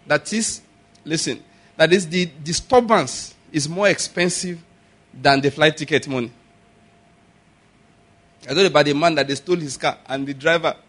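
ordinary talk

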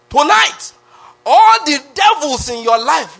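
high, loud drawn-out battle cry; mock prayer-rally shout. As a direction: bellow it like a vigil leader rallying the crowd. Mimicking night vigil war cries.

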